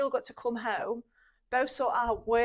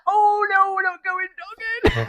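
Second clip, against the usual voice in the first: loud high mock alarm; horrified realisation; 10 semitones higher, 10 dB louder than usual. Teacher realising her rude slip, loud and high.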